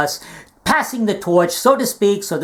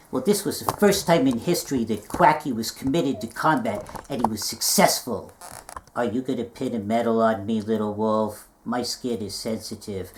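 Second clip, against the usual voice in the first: low soft puppet voice; 9 semitones lower, 7 dB quieter than usual. Acted.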